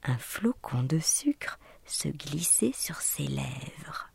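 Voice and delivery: ordinary delivery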